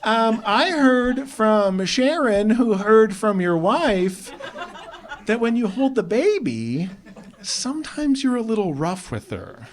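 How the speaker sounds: high, slow, drawn-out mom voice; high-pitched nagging mom impression; mom on the phone. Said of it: "My mom calling me out of nowhere".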